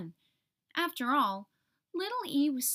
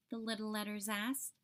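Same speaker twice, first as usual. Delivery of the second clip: usual speaking voice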